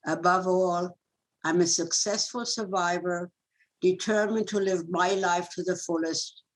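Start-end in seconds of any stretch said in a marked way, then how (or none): none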